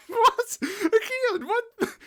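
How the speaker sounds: very high, loud disbelief; incredulous outburst. Real, not acted. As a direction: squawk it in bewilderment. Incredulous reaction to the list.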